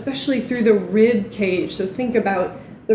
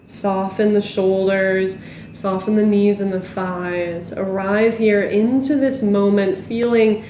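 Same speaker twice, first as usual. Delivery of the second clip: guided relaxation. Acted. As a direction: ease them down into stillness. Guides a cool-down.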